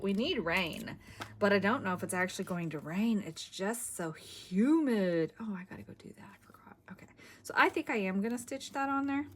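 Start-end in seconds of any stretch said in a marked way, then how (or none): 5.4-7.1 whispered note to self; muttered aside. Real, not acted. Crafter remembering a forgotten chore, whispered aside to herself. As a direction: drop to a near-silent whisper, talking to yourself.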